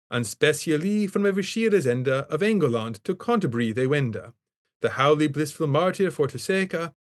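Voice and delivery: put-on Chaucer's Middle English, vowels sounded full and old; reading Middle English aloud. Shown as a demonstration. Professor reading Chaucer aloud to class in Middle English. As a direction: recite the opening as Chaucer's verse, measured and rolling. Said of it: "I'm gonna read the first eighteen lines in Middle English".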